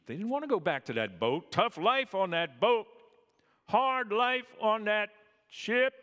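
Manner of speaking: high loud mocking sailor complaint; mocking whine. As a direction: wail it like a spoiled sailor.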